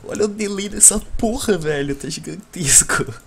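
angry. Real, not acted. Complaining loudly about voice lag.

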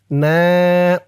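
"না" chanted on a long steady vowel; one-alif stretch. Shown as a demonstration. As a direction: draw out "না". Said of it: "না এক আলিফ টান". ("না" এক আলিফ টেনে)

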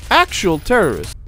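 high, loud outburst; loud indignant exclamation. Real, not acted. Player accusing an enemy in the game, indignant shout. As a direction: call it out in outrage.

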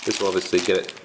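ordinary delivery

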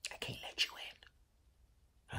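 slow, breathy secret whisper. Retold or imitated. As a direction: lean in and whisper it slowly. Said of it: "He bend over and whispered to me".

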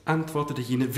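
plain reading voice

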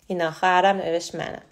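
usual speaking voice

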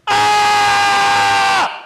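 put-on high, loud kid's scream; kids screaming. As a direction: shriek as the bell goes. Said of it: "they go".

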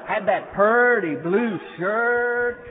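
slow, loud, savoring every word; Andy marveling at the trooper's uniform, drawn out loud and slow; awed drawl. Acted.

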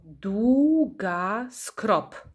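"długa z krop" slow, in measured beats; syllable by syllable. Shown as a demonstration. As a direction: draw out "długa z krop", one syllable per beat. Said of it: "ilość sylab w nazwie pokrywa się".

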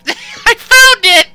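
laughing, thrilled shout; excited. Real, not acted. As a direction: burst out laughing with triumph. Finding the hidden item, laughing.

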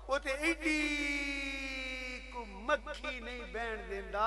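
very slow, stretched sermon phrasing; slow preacher's drawl for effect; drawn-out emphasis. Real, not acted. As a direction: hold the vowels long, almost chanting. Preacher draws out the tiny fly.

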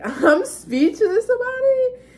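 high, loud, slow laugh through the words; real laughter, speechless at her own dreams. Real, not acted.

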